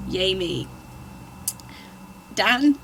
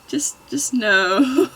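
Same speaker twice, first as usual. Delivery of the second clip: cringing; 6 semitones higher, 7 dB louder, slower than usual. Real, not acted.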